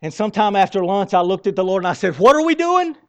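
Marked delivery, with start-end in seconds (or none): 2.2-3.0 higher and louder, frustrated; a frustrated believer calls out to God, in an exasperated loud cry. Retold or imitated. Loud exasperated cry.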